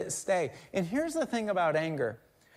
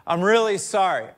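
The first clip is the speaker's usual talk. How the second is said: louder, pressing acted "sorry"; insistent mock apology; 8 dB louder than usual. Repeat offender apologizes to the forgiver, insistent and loud. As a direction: push the apology out loudly.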